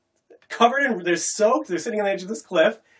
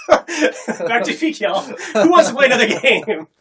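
louder, slow, laughing dismissal; mock shrug; 6 semitones higher, 7 dB louder, slower than usual. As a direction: toss it off like nothing happened.

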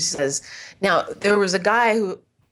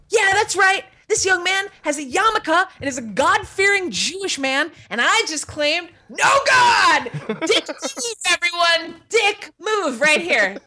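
mock boast; 8 semitones higher than usual. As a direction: hype the crowd over your own dick move. Onstage boast to crowd.

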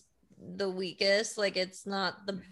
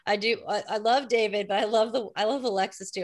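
ordinary talk

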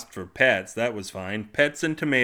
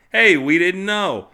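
defensive protest; 8 semitones higher, 9 dB louder, slower than usual. Acted. Humans defending themselves.

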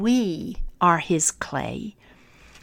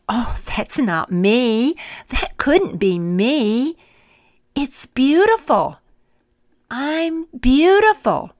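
rising, louder wonder; amazed; 6 semitones higher, 5 dB louder than usual. Acted. Teacup seeing itself in the mirror, amazed. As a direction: marvel at your own reflection.

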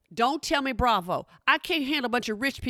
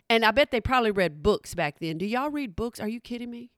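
ordinary talk

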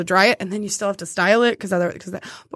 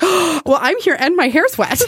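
gasp, then high, louder excitement; mock eager volunteering; 6 semitones higher, 4 dB louder than usual. Speaker retelling offering her wet hair to the stylist, eager. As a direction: imitate jumping at the chance.

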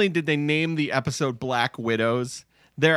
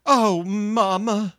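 Southern mama; 6 semitones higher, slower than usual. Retold or imitated. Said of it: "Audrey starts with a real just like".

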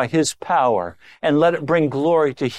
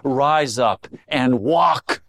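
slow, clear command; much slower than usual. Retold or imitated. Preacher voicing Jesus telling the listener to rise, slow and clear. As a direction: Rise up and walk, each word drawn out slowly. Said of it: "hearing Jesus say very clearly to you".

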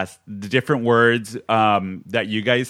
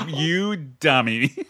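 slow mock put-down; 3 semitones higher, much slower than usual. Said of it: "You'd be like".

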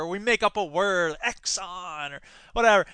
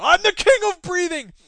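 higher, loud grandiose boast; hammed-up triumphant quote; mock proclamation; 10 semitones higher, 7 dB louder than usual. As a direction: shout it like a silly king. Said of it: "it's like Patton Oswalt".

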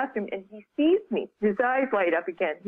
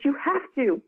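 put-on higher-pitched Irish boy pleading; Irish accent; 6 semitones higher than usual. Boy urging a stranger to come home, light Irish accent. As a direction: lightly imitate an Irish child insisting. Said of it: "in an Irish accent".

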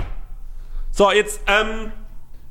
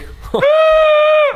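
high shriek; 5 dB louder than usual. Acted. Lauter Schrei nach Pause.

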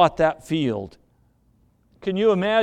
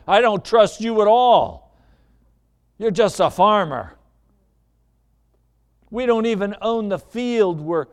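loud, higher-pitched acted indignation; mad wife; 3 semitones higher, 4 dB louder than usual. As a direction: lash out at your husband, loud and high. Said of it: "I mean, she was mad".

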